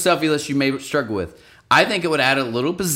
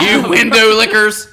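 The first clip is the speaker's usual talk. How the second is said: loud, high mock insult, drawn out; dad's insult; 7 semitones higher, 7 dB louder, slower than usual. Riffing on dad's old insult, mock jeer. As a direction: hurl it slowly like a teasing dad. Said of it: "the insult my dad would always give".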